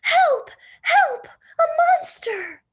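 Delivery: very high, very loud shrieks; panicked screams. Acted. Baby screaming for help at a monster, shrill panic. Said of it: "shouted Baby".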